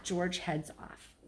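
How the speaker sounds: plain reading voice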